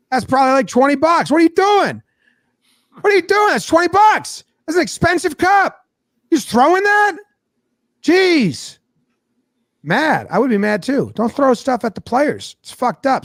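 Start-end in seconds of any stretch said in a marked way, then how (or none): 0.1-8.8 high-pitched scandalized scolding; indignant. Retold or imitated. Bystander scolding fans for throwing cups, high indignant voice. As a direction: act out shrieky disbelief at the waste.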